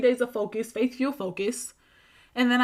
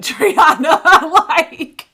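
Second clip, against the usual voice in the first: loud, high burst of laughter through the words; laughing hard; 5 semitones higher, 12 dB louder, much slower than usual. Retold or imitated. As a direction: imitate someone cracking up mid-sentence. Client's reaction voiced through loud laughter.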